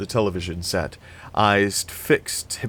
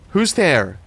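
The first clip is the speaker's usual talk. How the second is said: wary call-out; 7 semitones higher, 5 dB louder than usual. A narrator's reading. Calling into dark after door slam.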